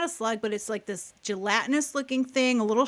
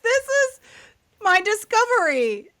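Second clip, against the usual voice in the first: high, louder, through laughter; laughing; 11 semitones higher, 6 dB louder than usual. Real, not acted. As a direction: announce it while giggling. Laughing at her own story.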